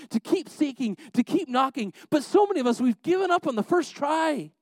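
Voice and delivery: high-pitched sermon voice building in force; impassioned preaching. Acted. Preacher urging church not to give up on prayer, impassioned.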